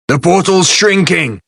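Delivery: high urgent yell. A narrator's reading. Flid warns everyone the portal is shrinking, yelling high. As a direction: shout the warning up high. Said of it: "he yelled".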